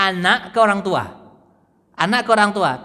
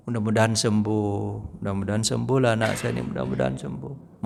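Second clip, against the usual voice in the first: quiet hopeful prayer; 11 semitones lower, 7 dB quieter than usual. Retold or imitated. Parent praying for sick child.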